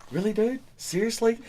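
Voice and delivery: high incredulous disbelief; exasperated. Retold or imitated. Telling how he reacted to the singer, exasperated. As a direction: act fed up with the singer. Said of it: "And it was like".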